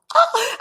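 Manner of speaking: sharp high gasp of delight; excited gasp. Retold or imitated. Retelling getting her first car, a high excited gasp. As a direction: gasp like you just got a gift.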